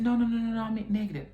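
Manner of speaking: frantic denial. Retold or imitated. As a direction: deny it in a loud, high rush. Hastily denies being pregnant to friend.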